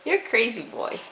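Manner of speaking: ordinary talk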